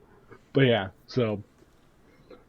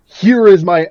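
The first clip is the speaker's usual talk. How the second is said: full-voiced; 5 semitones higher, 9 dB louder than usual. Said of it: "fully spoken and full on".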